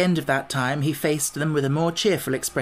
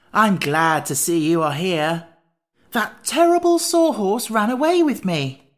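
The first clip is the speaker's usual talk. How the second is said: slow, raised, emphatic delight; 6 semitones higher, 5 dB louder than usual. A narrator's reading. Jack tells his parent of the runaway sawhorse, delightedly. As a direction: stretch the words out with eager delight. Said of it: "he cried delightedly".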